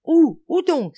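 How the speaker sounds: very high, loud, urgent question; eager exclamation. A narrator's reading. Boys hunting a frog, eager exclaimed question. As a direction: burst out wanting to know. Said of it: "s'exclamèrent-ils tous en sourdine".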